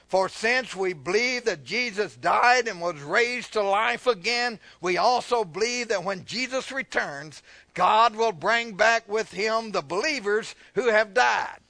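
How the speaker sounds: slow, emphatic scripture delivery; preached scripture reading. Real, not acted. Preacher reading on Jesus' return, slow and emphatic. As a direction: stretch the key words as you read to the church. Reads scripture aloud to congregation.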